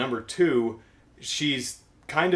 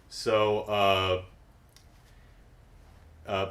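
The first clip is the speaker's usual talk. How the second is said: ordinary talk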